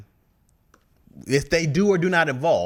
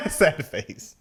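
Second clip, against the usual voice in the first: laughing read; 3 semitones higher, 4 dB louder than usual. Real, not acted. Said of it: "He said".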